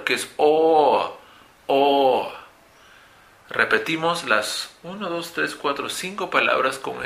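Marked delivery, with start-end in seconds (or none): 0.4-2.3 "O" held long as aw; long vowel demo. Shown as a demonstration. Teacher isolates O from board, long vowel demo. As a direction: sustain each "O", falling. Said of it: "un sonido más largo".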